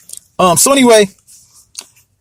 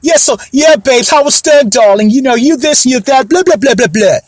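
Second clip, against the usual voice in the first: Wendy Williams impression; 4 semitones higher than usual. Vlogger clowning a talk host's breezy voice. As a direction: ham up her babes-and-darling chatter. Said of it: "that's how she talks".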